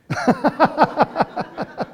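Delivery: cracking up hard at your own punchline; loud high laugh. Real, not acted. Cracking up after telling the car painter mix-up, loud high laugh.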